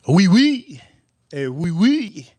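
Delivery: high, insistent fake French; mock French accent. Customer pressing his order on waiter, mock French accent. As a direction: play the pushy customer in pretend French.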